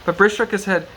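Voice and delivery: ordinary delivery, nothing marked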